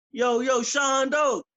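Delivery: loud call from afar; shouted greeting across the street. Retold or imitated. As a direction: yell it down the block to your son. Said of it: "He'd call down the street to me".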